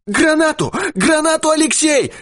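frantic high shout. A narrator's reading. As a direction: yell for the grenade in panic. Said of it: "кричал Дауги".

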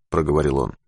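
ordinary delivery, nothing marked

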